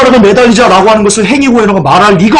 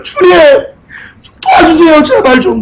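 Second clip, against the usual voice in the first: higher, tearful begging to the Lord; crying plea; 10 semitones higher than usual. Acted. A believer weeping before the Lord, begging for help. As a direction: plead through tears for help. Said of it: "막 우는 거예요".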